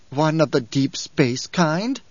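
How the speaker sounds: slow, drawn-out question full of loathing; fanatic loathing. A narrator's reading. Fanatic asking about the Guild envoy, with loathing. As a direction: draw it out with fanatical distaste. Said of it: "his voice full of fanatic loathing".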